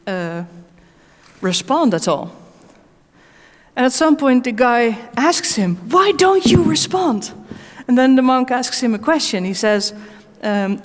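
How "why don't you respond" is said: high, drawn-out mock outburst; exasperated angry demand. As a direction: imitate an upset man demanding an answer. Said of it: "He gets more and more angry".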